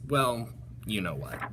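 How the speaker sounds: usual speaking voice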